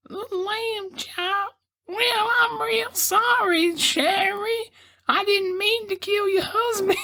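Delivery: very high, slow squeaky puppet voice; Lamb Chop. Acted. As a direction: squeak it out in a drawn-out falsetto. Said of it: "That's how he talks".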